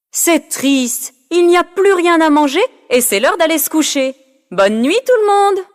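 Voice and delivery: high mock-sad teasing voice; teasing Karine. Karine teases the hungry kids, high and mock-sad. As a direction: tease them with fake sadness, high.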